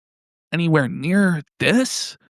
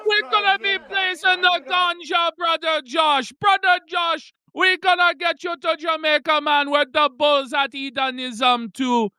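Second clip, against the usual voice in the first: high, shouted hammed-up Jamaican accent; mock Jamaican accent; 9 semitones higher than usual. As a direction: bellow it like a parody Rasta. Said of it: "worst Jamaican accent of all time".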